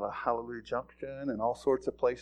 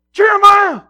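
much higher and louder, yelling at his little brother; an angry older brother calling him back; mad big brother yell; 21 dB louder, slower than usual. Retold or imitated. Older son yelling at his straggling brother angrily. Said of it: "He's getting mad at him".

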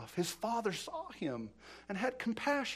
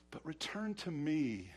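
softer, lower, slow plea; tender appeal; 5 semitones lower, 6 dB quieter, slower than usual. Retold or imitated. God calling the unfaithful back, tenderly. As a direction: drop down and draw it out gently.

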